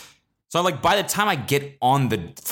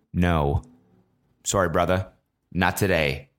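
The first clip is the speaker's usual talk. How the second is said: low, unmoved brush-off with pauses; deadpan refusal; 5 semitones lower than usual. Acted. Drunk passenger refusing scared seatmate's hand, low deadpan. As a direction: turn him down low and cool. Re-enacts his cool refusal.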